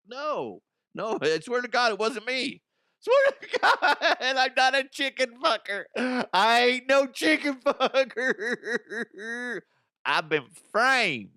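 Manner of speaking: high, loud pleading broken by laughter; laughing protest. Pretend. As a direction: beg to be believed while cracking up. Imagined self protesting innocence.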